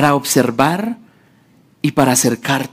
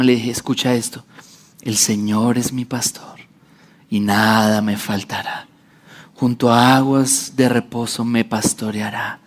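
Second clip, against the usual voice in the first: softer, lower bedtime calm; father calming his frightened daughter with a psalm, softly; soothing father; 5 semitones lower, 4 dB quieter than usual. Retold or imitated. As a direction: hush a scared child to sleep. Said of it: "estaba llorando".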